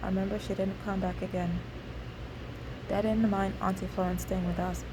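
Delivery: usual speaking voice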